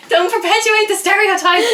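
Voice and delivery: high, laughing scold; laughing protest. Real, not acted. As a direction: scold while cracking up. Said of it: "laughing protest".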